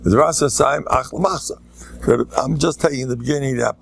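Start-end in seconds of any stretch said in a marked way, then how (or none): none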